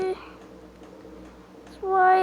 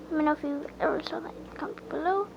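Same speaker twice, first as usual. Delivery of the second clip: fast whispered aside; whispering; 9 dB quieter, much faster than usual. Real, not acted. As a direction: whisper it quickly. Aside to viewers.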